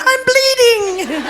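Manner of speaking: very high, drawn-out mock squeal; girlish squeal. Retelling being beaten up to the panel, girlish squeal. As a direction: squeal it long and high, then laugh. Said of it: "squealed like a girl".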